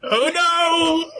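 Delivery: high mock panic cry; terrified horror victim. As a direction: shriek like the monster just arrived. Mock panic at Mark Rain.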